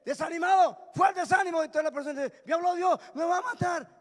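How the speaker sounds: high, loud, punchy exclamations; shouted preaching. Real, not acted. Preacher voicing people startled by God speaking, high shouted preaching. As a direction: cry it out in bursts.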